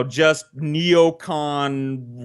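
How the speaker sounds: usual speaking voice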